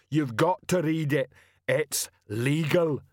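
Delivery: troll sergeant announcing to the crowd; slow troll. A narrator's reading. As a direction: spell it out slowly like a dim troll.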